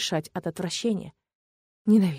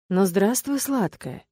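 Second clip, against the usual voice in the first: plain reading voice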